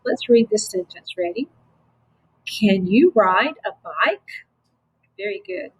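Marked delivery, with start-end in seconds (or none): 2.4-4.2 Can you ride a bike, rising on BIKE; question rise. Shown as a demonstration. Teacher modelling a question for pupils, bike going up. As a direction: read it slowly and send bike up at the end. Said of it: "hope that your voice went up on the end".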